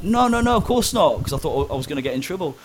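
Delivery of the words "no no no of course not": high, hurried mock panic; nervous denial. Prisoner afraid of trouble answering the officer, high nervous denial. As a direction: squeak out a worried denial. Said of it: "I was like".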